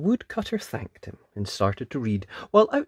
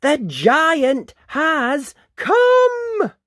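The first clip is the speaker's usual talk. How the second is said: high, loud, drawn-out warning; yelled alarm; 9 dB louder, much slower than usual. A narrator's reading. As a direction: yell it slowly, each word ringing out. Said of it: "Missus Jones yelled".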